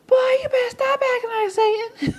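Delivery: high, fast, loud mock scolding; scolding parent. Parent warning misbehaving kids about Santa, shrill scolding. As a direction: nag the kids like a fed-up parent. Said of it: "when you act up".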